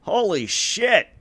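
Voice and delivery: high, slow drawn-out exclaim; amazed. Acted. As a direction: stretch out the shock. NPC amazed again.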